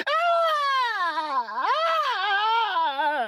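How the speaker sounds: high, shrieking cry of fear; witch's cry of fear. A narrator's reading. Witch doused with water, shrieking in fear. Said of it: "a loud cry of fear".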